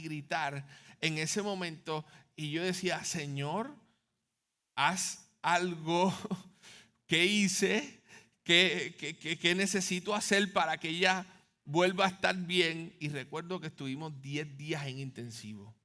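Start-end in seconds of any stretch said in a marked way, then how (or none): 3.0-12.9 loud, pleading outcry breaking upward; desperate cry to God. Retold or imitated. Father at ICU begging God, loud pleading cry.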